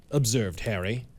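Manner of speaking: ordinary delivery, nothing marked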